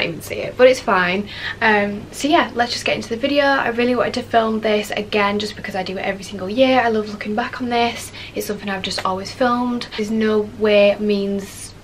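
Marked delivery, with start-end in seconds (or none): none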